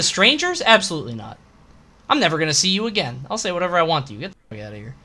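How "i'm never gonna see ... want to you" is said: ordinary talk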